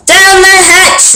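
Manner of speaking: high, slow shout; crew shout. Acted. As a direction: shout it back together. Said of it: "we all shouted".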